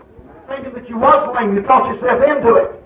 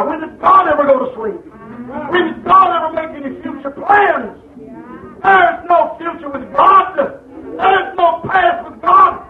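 preaching shout; 6 semitones higher than usual. Acted. Preacher to church, rising to a shout. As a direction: shout the question at the pews.

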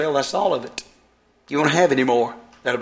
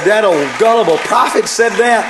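much louder and higher, hammering each line; shouted preaching; 7 semitones higher, 7 dB louder than usual. Acted. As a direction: preach it out over the crowd. Preacher voices a doubter loudly.

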